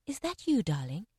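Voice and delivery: soft, gentle question, falling away on the endearment. A narrator's reading. Waking mother greets her daughter, gently. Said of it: "The mother said gently".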